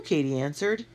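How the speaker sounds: ordinary delivery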